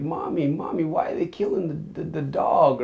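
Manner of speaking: put-on high, frightened little kid crying out to mommy; scared child. Frightened four-year-old calling to his mother, in a high scared child voice. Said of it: "I was like".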